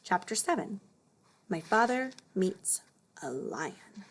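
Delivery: ordinary delivery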